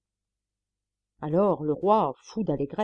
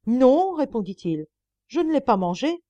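higher, louder stubborn denial; desperate denial; 7 semitones higher, 6 dB louder than usual. A narrator's reading. Drowning monk still lies to his brother, louder and higher. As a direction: deny it harder as the water rises. Water at his mouth, denying again.

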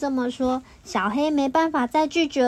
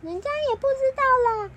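whiny crayon; 12 semitones higher, much faster than usual. Acted. Crayons arguing, shifting blame.

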